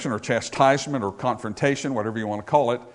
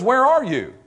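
raised, high-pitched demand as God; God confronting; 8 semitones higher, 3 dB louder than usual. Acted. Preacher as God showing up on the scene, high and demanding. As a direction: call out like God searching for a sinner. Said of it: "God shows up on the scene sometimes and says".

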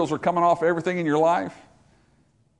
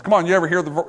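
usual speaking voice

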